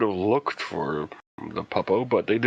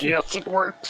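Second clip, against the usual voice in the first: excited; 10 semitones higher, slower than usual. Retold or imitated. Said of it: "They're like".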